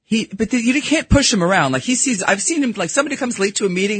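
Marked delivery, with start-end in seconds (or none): none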